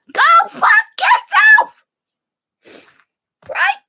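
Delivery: very high, loud, drawn-out yell; telling-off shout. Acted. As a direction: hurl it slowly at your critics. Telling off her critics.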